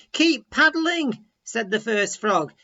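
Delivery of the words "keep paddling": high, loud and slow call; urgent cheer. A narrator's reading. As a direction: urge your friend on. Frog urging friend on.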